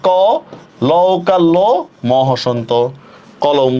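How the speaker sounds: কলম broken into "kaw", "lo", closing m; letter-by-letter teaching of কলম as "kaw-lom"; pronunciation spelled out. Shown as a demonstration. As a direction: spell কলম slowly in pieces, open "kaw", rounded "lo". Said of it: "তাহলে কি হবে?".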